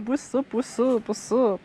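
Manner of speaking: normal talking voice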